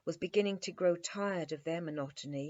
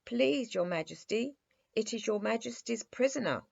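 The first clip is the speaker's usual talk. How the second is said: deferential; 6 semitones higher, 3 dB louder than usual. A narrator's reading. Captain answers king deferentially.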